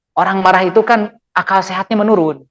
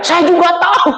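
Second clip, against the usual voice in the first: mock angry; 9 semitones higher than usual. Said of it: "pasti marah dia".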